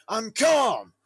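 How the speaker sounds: high, loud, exploding yell of denial; furious shout. Retold or imitated. The man on the plane finally snapping at the passenger, shouting. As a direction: blow up while insisting you're calm. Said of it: "that's the only time he raised his voice".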